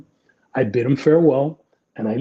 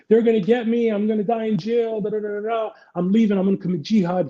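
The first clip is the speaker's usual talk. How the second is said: high, rushed panic; mild mock panic of a man fleeing; panicked; 7 semitones higher than usual. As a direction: blurt it like he's cornered. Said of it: "He panics".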